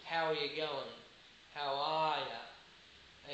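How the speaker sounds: relaxed Australian "How are ya?", the R dropped, ya drawn out slowly; slow, easy Australian "How are ya?" like a mate in the street; Australian accent. Shown as a demonstration. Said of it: "so we say in Australia".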